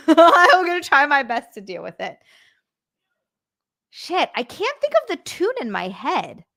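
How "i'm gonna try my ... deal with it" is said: louder, through a laugh. Real, not acted. Streamer to Frank, laughing as she promises again. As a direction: laugh it out, a little sheepish.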